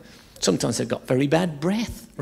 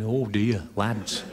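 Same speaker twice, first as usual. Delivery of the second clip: low, soft, rueful sympathy; a comedian consoling the troops, low and soft; Jim Bowen; 8 semitones lower, 8 dB quieter, much slower than usual. Retold or imitated. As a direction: commiserate with the lads gently.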